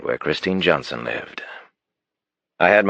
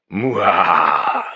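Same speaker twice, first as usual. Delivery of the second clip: mock monster smooch; 4 semitones higher, 3 dB louder than usual. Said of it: "I did my favorite monster imitation".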